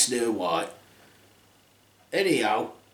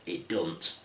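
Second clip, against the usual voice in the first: put-on old hag; 10 semitones lower, 12 dB quieter than usual. Old queen curt reply.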